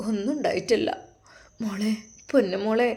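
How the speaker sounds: soft, tender unvoiced whisper. Mother comforting her pregnant daughter, whispered. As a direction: hush her gently, breath only.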